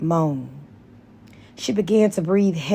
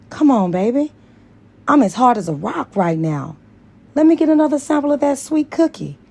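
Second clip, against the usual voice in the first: begging; 7 semitones higher than usual. Acted. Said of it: "Tony begged".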